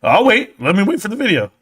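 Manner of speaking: mock friend. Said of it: "And he's like".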